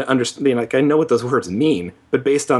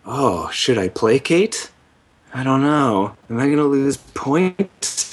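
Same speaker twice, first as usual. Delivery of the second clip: hesitant; 2 semitones higher, slower than usual. Retold or imitated. Imitating self deciding in game.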